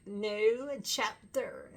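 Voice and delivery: a very slow mock new chapter; slow drawn-out imitation. Fan retelling the concert line, slow mock chant. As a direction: stretch it out, imitating Johnny. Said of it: "he kept saying it real, real slow".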